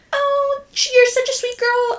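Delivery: high mock sweet voice of the old boss; imitating Gloria. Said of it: "she was like".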